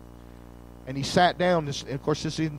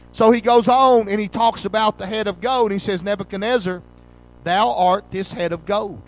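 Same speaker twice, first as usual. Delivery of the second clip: louder, rising preacher's cry, pressing each claim home; fiery preaching; 2 semitones higher, 5 dB louder than usual. Real, not acted. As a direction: preach dominion to the congregation at full force. Preacher builds to full force.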